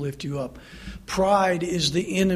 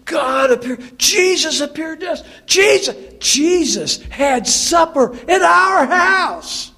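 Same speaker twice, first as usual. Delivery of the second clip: much higher shouted bragging; boastful shouting; 8 semitones higher, 4 dB louder than usual. Retold or imitated. Disciples bragging at a shout about Jesus' supper. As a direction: crow it out to everyone. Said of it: "full of pride and boastfulness".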